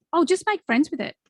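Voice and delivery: casual advice. Retold or imitated. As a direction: toss it off as simple advice. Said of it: "someone once said to me".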